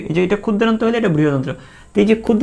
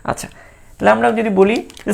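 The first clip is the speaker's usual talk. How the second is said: ordinary talk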